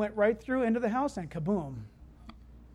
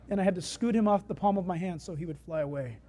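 normal talking voice

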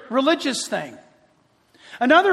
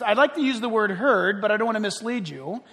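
ordinary talk

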